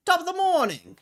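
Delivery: high, loud newsboy holler. Pretend. Newsboy greeting a customer to sell shares, high hawking shout. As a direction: hawk it like an old-time newsboy. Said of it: "Just so I can talk in that voice".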